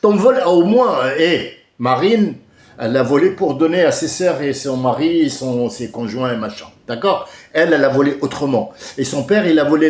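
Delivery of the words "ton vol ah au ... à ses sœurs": loud, high outburst; heated shouting. Real, not acted. Speaker accuses a politician of theft, shouting.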